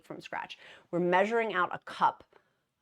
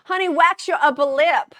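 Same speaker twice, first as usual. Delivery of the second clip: higher, louder sassy put-down; mock sassy jab; 9 semitones higher, 11 dB louder than usual. Roasting her own mustache photo, a sassy higher jab. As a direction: tease yourself like a blunt friend.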